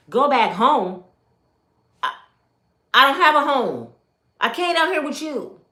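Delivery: a loud, disbelieving recreated protest; retold outburst. Retold argument with her husband.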